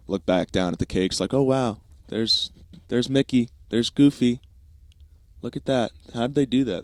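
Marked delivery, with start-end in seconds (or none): 1.3-6.8 higher, lightly voiced amazed kid; acted childlike wonder. Kid admiring Disney cakes, acted wonder. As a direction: mimic a kid in awe.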